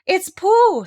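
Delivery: high, loud thrilled cry; excited. Acted. Christopher Robin hears Pooh singing, excited cry. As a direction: burst out with joy. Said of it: "said Christopher Robin excitedly".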